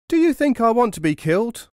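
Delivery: high terrified cry; frightened cry. A narrator's reading. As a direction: beg not to be killed. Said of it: "cried the other robber".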